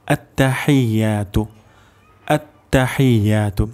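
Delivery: আত্তাহিয়াতু said slowly, each syllable clear; slow recitation demo. Shown as a demonstration. Teacher recites আত্তাহিয়াতু slowly for students. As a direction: model আত্তাহিয়াতু slowly for the class. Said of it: "প্রথম শব্দটি হলো".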